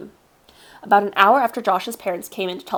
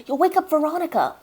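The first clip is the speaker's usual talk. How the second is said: put-on higher urgent boy voice; scolding kid; 9 semitones higher than usual. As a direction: warn a friend sharply. Josh scolding.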